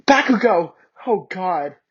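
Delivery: high, loud cry of pleasure; moaned. Acted. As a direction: cry out his name. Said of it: "I moaned".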